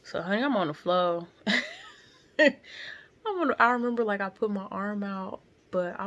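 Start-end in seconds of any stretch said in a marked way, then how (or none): 1.3-1.9 short high laugh. Real, not acted. Woman laughing at her own fall, short high laugh. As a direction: break off into a quick laugh.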